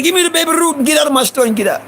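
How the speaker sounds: high storekeeper ordering someone out; angry Iranian shopkeeper. Retold or imitated. Shopkeeper demands candy and ejects boy, imitated high and angry. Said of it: "He getting mad".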